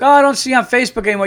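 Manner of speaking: high, loud, rushed sugary chirp; mock fake-friendly. Mocking a nosy acquaintance in a fake-sweet chirp. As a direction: gush it out like a phony friend.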